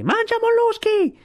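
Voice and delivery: strillo di scherno molto acuto e forte; grido di scherno. Acted. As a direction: strillo beffardo più forte che può. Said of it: "gridò più forte che poté". (Kotick deride Sivic: grido acutissimo)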